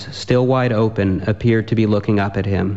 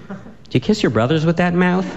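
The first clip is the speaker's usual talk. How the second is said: cheeky comeback; 8 semitones higher than usual. Acted. David's mocking comeback.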